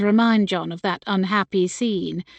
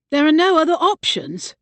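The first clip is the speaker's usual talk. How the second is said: rising voice; 7 semitones higher, 4 dB louder than usual. A narrator's reading. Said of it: "voice rising".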